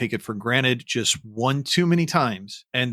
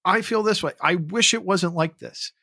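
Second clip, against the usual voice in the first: higher, pleading frustration; emotional venting; 7 semitones higher than usual. Retold or imitated. To the call center agent, voicing frustration higher and pleading. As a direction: let the frustration rise up in pitch. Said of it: "I will say".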